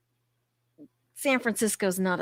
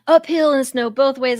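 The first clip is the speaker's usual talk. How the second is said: put-on louder, higher old-man boast; mock dad; 5 semitones higher, 7 dB louder than usual. Dad bragging about his childhood, mock boast. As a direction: puff up like a grandpa telling tales. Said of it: "My dad used to say that".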